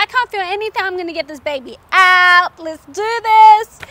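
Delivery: high, loud, pumped-up cry; psyched up. Retold or imitated. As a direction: fire herself up for the push. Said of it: "I was psyched".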